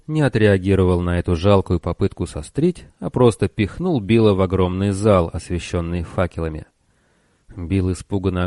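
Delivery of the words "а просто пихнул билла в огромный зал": ordinary delivery, nothing marked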